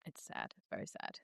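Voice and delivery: whispered, almost voiceless sadness; hushed sad aside. Real, not acted. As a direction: drop to a breathy whisper of sympathy.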